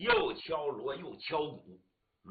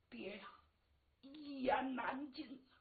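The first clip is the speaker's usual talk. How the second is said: rueful sighing; 8 semitones higher, 6 dB quieter than usual. Acted. Daughter reluctant to explain to her father, rueful sighing. Daughter hedges before confessing.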